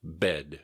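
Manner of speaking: "Bed." with the final D sounded; a careful "Bed." that keeps its D; clearly pronounced D. Shown as a demonstration. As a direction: finish "Bed." on an audible D. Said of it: "pronounce the D at the end".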